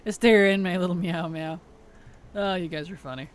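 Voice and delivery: usual speaking voice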